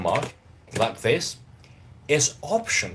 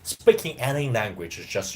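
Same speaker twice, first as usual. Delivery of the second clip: usual speaking voice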